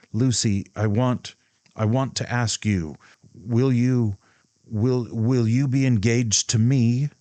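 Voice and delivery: halting, stumbling proposal; stammering. A narrator's reading. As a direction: stumble over the words nervously.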